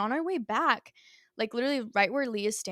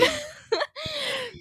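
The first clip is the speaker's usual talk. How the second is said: a high laugh half sobbing; crying laugh. Real, not acted. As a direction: laugh through tears at yourself. Laughing about her own meltdown.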